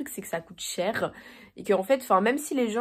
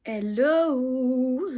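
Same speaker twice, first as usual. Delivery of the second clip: sing-song drawn-out rising hello; imitating Louise's hello; 3 semitones higher than usual. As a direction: borrow a YouTuber's cheery greeting. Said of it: "J'ai fait le même hello que, euh, Louise". (imitation du hello de Louise)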